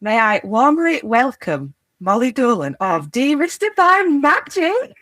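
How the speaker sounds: bright announcer read of the guest intro; upbeat host introducing the guest; podcast intro. Acted. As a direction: welcome listeners like a show host.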